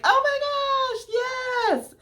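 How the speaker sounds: high, slow, gushing yes; excited. Retold or imitated. Mary accepting Joseph's proposal, excited high gush. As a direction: squeal it out slowly with joy. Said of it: "Like, she's excited".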